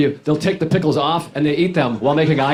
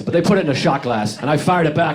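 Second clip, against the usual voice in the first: ordinary talk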